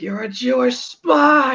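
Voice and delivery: high, slow put-on Hitler voice; Hitler accusation. Hitler accusing the kid, high slow mock voice. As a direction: accuse him in a hammed-up villain voice. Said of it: "he's like".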